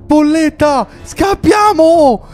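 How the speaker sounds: frightened shout to escape; panicked call to flee. Acted. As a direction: yell at her to run. Fleeing the horseman.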